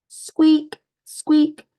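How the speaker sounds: high squeaky creaking noise; playful high squeak sound; squeaky merry-go-round. Acted. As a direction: squeak it high like a turning ride.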